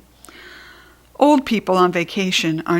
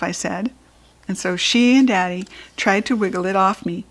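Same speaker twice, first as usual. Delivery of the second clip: ordinary delivery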